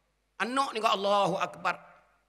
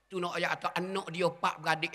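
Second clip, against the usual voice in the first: usual speaking voice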